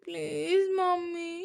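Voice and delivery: put-on high, slow, whimpering "mummy" from a little girl; cute plea. A narrator's reading. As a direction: act cute and whiny to win mom over. (ముద్దుగా, గారాబంగా "mummy" అనే పాప)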